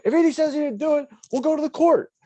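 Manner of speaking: high, forceful mock stepdad voice; indignant stepdad. Stepdad defending the accused kid, high-pitched mock indignation. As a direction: imitate a stepdad defiantly taking his side.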